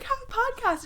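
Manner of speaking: squeaky eager plug; blurting it out, high and bright; high excited pitch. Retold or imitated.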